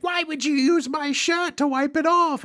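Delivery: mock Australian kid, high and squeaky; a deliberately terrible Australian accent; bad Australian accent. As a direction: worst Australian accent ever, imitating a kid. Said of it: "I'm doing the worst Australian accent you've ever heard".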